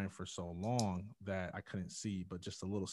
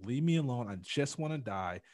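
raised, louder plea to be left alone; insistent refusal; 4 semitones higher, 6 dB louder than usual. Retold or imitated. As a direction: push her away, voice jumping up then dragging. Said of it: "I just kept saying to her".

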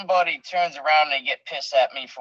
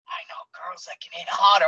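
mock half-whispered jab; a hushed, needling retort; retold taunt; 4 semitones higher than usual. Wrestler needling his opponent mid-match, hushed taunt.

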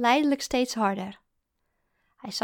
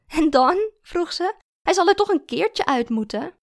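louder, higher challenge; pointed, raised-pitch question; challenging; 7 semitones higher, 7 dB louder than usual. A narrator's reading. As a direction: throw it back at him sceptically. Challenges him, she laughed scornfully.

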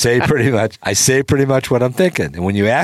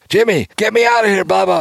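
high, loud yell for rescue; panicked yelling; 11 semitones higher, 3 dB louder than usual. Retold or imitated. Gene calling to Jimmy from the stuck elevator, yelling high and loud. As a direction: plead to be pulled out, yelling. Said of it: "I hear him screaming and yelling".

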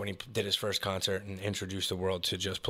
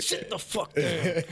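mock yell; 7 semitones higher, slower than usual. Retelling a passenger scene.

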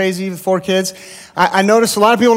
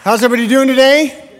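loud, higher call to the whole room; rally the congregation with a raised greeting; calling out to the crowd; 6 semitones higher, 5 dB louder than usual. Real, not acted.

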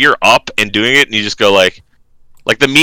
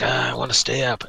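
soft mock whine; understated whiny imitation; 10 dB quieter than usual. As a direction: imitate a kid whining, quietly. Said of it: "he was kinda whiny".

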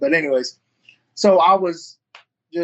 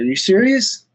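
incredulous question, pitched up; retold disbelief; 5 semitones higher, 4 dB louder than usual. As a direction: ask it higher, as if you can't believe it. Retelling his disbelief at the offer.

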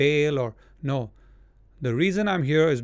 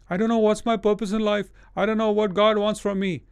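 mock lament; 5 semitones higher, 3 dB louder than usual. Imitating a confused believer.

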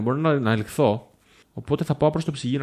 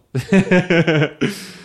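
higher, louder burst of laughter; 4 semitones higher, 6 dB louder than usual. Real, not acted. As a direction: laugh out loud at your own remark. Laughs after own remark. (δυνατό γέλιο)

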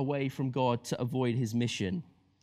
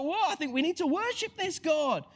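much higher, wide-eyed whoa; amazed sailors; 12 semitones higher than usual. Pretend. Sailors discovering God, higher and amazed. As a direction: gasp in awe then decide. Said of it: "they're like".

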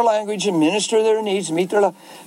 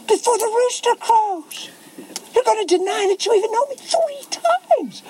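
acted incredulous prophecy; 13 semitones higher than usual. Jesus foretelling Peter's denial, high acted disbelief. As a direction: pitch it way up and stretch the words.

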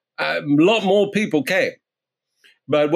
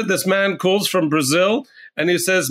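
normal talking voice

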